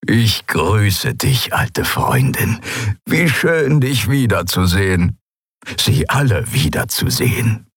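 put-on slow, glad old-cat welcome; happy old Skria. A narrator's reading. Suran welcoming the crew home, slow and glad. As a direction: greet an old friend with joy. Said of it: "schien seinerseits sehr glücklich".

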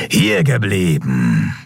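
slow, drawn-out growly order; growled command. A narrator's reading. Cult leader halting the fugitives, growled command. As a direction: hold them with a slow rumbled command. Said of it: "brummte ihr Anführer".

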